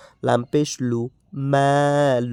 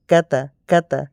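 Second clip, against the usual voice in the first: normal talking voice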